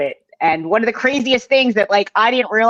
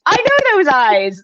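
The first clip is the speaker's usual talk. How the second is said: slow knowing recognition; 6 semitones higher, 3 dB louder, slower than usual. Retold or imitated. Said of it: "you're gonna be like".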